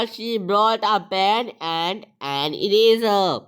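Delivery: very slow, clear full-sentence reading; slow dictation. Shown as a demonstration. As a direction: read the finished answer slowly. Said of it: "complete क्या हुआ?". (पूरा वाक्य धीरे-धीरे पढ़ना)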